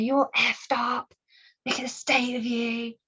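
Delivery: slow, sneering put-on voice of a bully; mocking bully. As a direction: drag the insult out with contempt. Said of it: "They're like".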